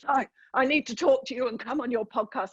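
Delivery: put-on lightly done South African accent; a hint of a big South African man. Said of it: "in his deep South African voice".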